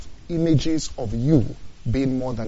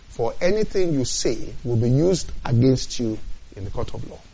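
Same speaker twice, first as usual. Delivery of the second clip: usual speaking voice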